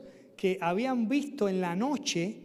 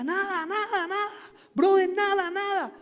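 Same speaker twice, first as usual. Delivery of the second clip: frantic urging; 12 semitones higher, 5 dB louder than usual. Retold or imitated. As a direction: yell at a brother to keep going. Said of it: "Y yo le decía".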